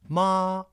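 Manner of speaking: ma held high and level; first tone. Shown as a demonstration. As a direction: sing "ma" on one high flat note. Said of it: "first tone".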